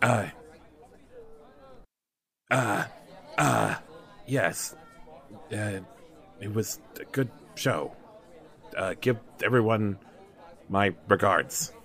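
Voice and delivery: low, halting, softened voice; quiet low-voiced patron, many pauses; reticent patron.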